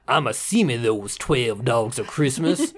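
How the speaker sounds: hammed-up Southern dad accent. Guy from New Hampshire putting on a heavy Southern accent. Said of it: "And he's like".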